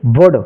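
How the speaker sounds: "वर्ड" as a short vud, no l; silent L. Shown as a demonstration. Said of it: "इसको क्या पढ़ेंगे".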